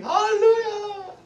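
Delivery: high, drawn-out hallelujah shout; shouting praise. Retold or imitated. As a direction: re-enact a loud cry of praise, stretched long. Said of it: "I was shouting".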